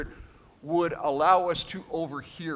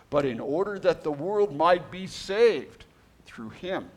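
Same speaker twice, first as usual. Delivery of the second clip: scripture reading; 4 semitones higher, 3 dB louder than usual. Acted. Reads scripture aloud.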